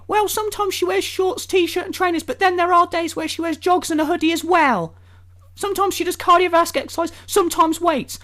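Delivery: high-pitched mock explainer; sarcastically squeaky, over-helpful voice; mocking imitation. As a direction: mimic him rambling on in a high voice. Mocking answer about vague description.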